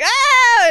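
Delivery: high, loud mock shriek; mock scream. People seeing Leto's gross protuberance, mock screaming. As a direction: let out a hammed-up shocked scream. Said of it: "And then people be like".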